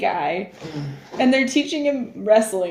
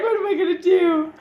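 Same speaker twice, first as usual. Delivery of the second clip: mock crying; 8 semitones higher than usual. Voicing crying friend again.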